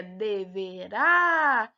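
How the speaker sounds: "deverá" slow, with the final á held long; stress on final RÁ. Shown as a demonstration. Teacher proving the last syllable is tonic, stretching the end of "deverá". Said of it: "quando a gente pronuncia".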